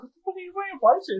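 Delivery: mock disbelief. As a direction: rattle it off in stunned disbelief. Retelling his own disbelief.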